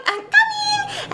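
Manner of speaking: very high, drawn-out singsong; sassy assistant. Acted. Assistant answering the doorbell, high drawn-out singsong. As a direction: trill it out in falsetto. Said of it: "he's a little sassy".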